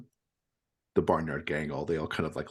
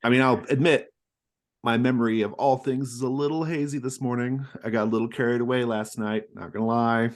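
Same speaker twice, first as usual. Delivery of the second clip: a bit higher, louder sheepish confession; hungover partier; 7 semitones higher, 8 dB louder than usual. Acted. Scott admits a hazy memory after partying, higher louder sheepish. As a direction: cheerfully own up to a wild night.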